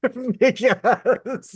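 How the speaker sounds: high laughing through the word; giggling aside. Real, not acted. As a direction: laugh as you say it. Laughing at own story.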